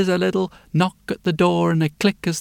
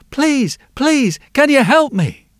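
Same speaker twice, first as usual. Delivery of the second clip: put-on little girl; 8 semitones higher, 4 dB louder, slower than usual. Little girl begging blacksmith.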